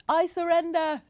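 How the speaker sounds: high, loud laughing surrender. A narrator's reading. Granigan waving her hat in the snow fight, loud laughing surrender. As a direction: give up through laughter. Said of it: "she laughed".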